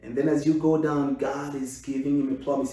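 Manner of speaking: ordinary talk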